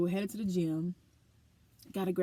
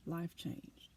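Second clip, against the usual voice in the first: hushed echo; 3 semitones lower, 13 dB quieter than usual. Real, not acted. Repeats it, near whisper.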